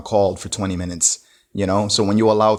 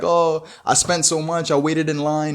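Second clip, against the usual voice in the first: higher, slow whiny fake sob; mock crying; 6 semitones higher, slower than usual. Gift-giver whining to the recipient, mock crying. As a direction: whine it like a gift-giver about to cry. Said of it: "you start crying like".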